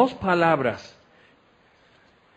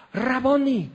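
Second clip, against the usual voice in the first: Mary's cry; 8 semitones higher, slower than usual. Acted. Mary recognizes Jesus, cries out.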